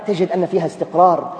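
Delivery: ordinary delivery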